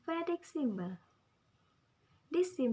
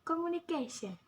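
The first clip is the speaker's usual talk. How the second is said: "Communication" with CA raised, falling after; stress on CA; 5 semitones higher than usual. Shown as a demonstration. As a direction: model CA in "Communication" for learners to repeat. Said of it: "The stress at the K".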